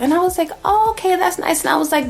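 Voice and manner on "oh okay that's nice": feigned pleasant. Retold or imitated.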